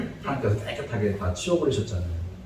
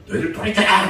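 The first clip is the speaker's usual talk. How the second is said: bold proclamation; 6 semitones higher than usual. Pretend. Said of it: "당당히 얘기하는 게 아니라".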